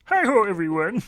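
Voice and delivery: high, loud frog puppet greeting; chirpy puppet frog hello; Kermit the Frog. Shown as a demonstration. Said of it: "that one's the trigger is".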